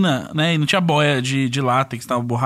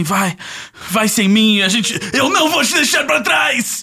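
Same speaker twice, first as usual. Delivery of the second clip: war movie shout; 3 semitones higher, much slower than usual. Acted. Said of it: "filmes de guerra e você fala assim".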